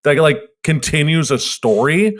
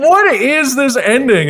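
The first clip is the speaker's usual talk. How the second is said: baffled; 9 semitones higher, slower than usual. Retold or imitated. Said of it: "you're like".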